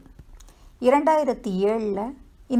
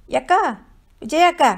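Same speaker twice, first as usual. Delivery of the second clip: higher, louder shout across the field; calling out; 8 semitones higher, 6 dB louder than usual. A narrator's reading. Running girl calls Vijayam from the field edge, urgent shout. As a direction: yell to catch her attention from afar.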